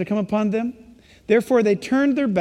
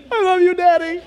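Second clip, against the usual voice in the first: put-on child's plea; 9 semitones higher, 5 dB louder than usual. Crying child voiced.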